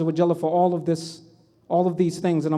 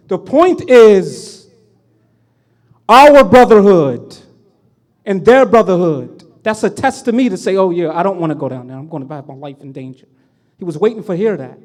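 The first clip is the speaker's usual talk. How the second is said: preaching at full force; 9 dB louder than usual. Acted. Preacher to the congregation on brotherhood, loud fervent preaching. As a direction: drive home brotherhood from the pulpit.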